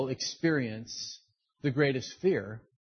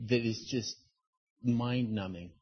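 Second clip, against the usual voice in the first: ordinary talk, nothing marked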